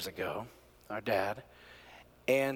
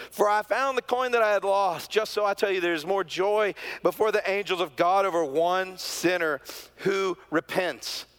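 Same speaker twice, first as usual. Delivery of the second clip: raised, lively reading of the parable; scripture reading; 7 semitones higher, 4 dB louder than usual. Acted. Preacher reading the parable to the congregation, lively and raised. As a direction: read the coin parable with rising joy.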